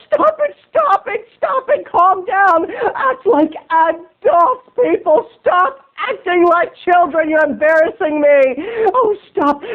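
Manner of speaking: very high, wailing, slowed plea to stop; whiny begging. Retold or imitated. Embarrassed dates begging the pranksters, high wailing whine. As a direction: beg like a mortified teen on the edge of tears. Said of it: "Leah and Richard were like".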